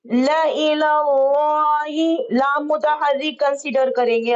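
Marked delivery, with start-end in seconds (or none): 0.0-2.3 ला इलाही recited very slowly, drawn out; slow tajweed demo. Shown as a demonstration. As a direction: recite it like a teacher showing the class. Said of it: "इसका इजरा देखिए". (ला इलाही धीमी तिलावत)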